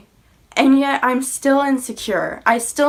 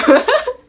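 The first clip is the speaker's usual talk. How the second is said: bright laugh; 9 dB louder than usual. Real, not acted. Laughs at her own accent.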